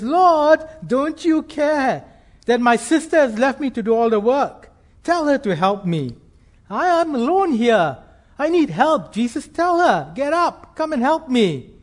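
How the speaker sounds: high-pitched, exasperated complaint to Jesus; Martha's overwhelmed, indignant outburst; frustrated plea. Acted. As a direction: plead like a harried hostess who's had enough. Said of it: "her frustration quickly boils over".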